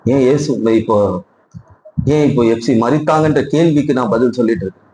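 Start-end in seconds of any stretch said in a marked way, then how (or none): none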